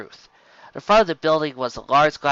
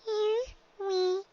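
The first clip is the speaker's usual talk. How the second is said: nervous filly; much slower than usual. Acted. Dicky steels herself at door.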